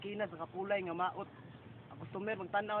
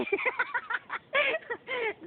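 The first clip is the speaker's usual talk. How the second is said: high-pitched peals of laughter; laughing fit; 4 dB louder than usual. Real, not acted. Battler breaking up mid-routine, high laughter. Laughs after shouted punchline.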